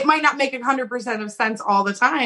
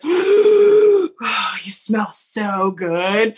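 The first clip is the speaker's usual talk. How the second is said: put-on drunk girl swooning; much slower than usual. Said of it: "It's like".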